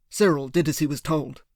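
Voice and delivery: usual speaking voice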